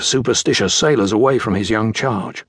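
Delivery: usual speaking voice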